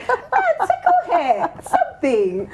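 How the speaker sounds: giggling through the words, pitch up; breaking into laughter mid-advice; laughing. Real, not acted. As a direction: laugh while you suggest it. Laughing while giving advice.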